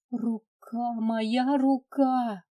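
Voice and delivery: slow, breaking tearful wail; tearful whispered vows. Acted. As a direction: wail it, voice cracking. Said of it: "срывающимся голосом произнесла, вернее, проплакала".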